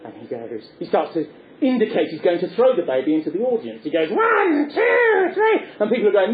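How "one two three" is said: high, drawn-out teasing count; Punch counting down. Acted. Punch threatens to throw the baby into the audience, high taunting countdown.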